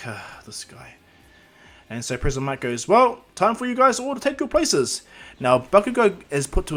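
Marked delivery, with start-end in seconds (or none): 0.1-1.0 soft, low sighed aside; exasperated aside. Acted. Exasperated aside after an interruption. 2.9-5.0 high, loud, drawn-out announcer call. Acted. Announcer calls contestants to places.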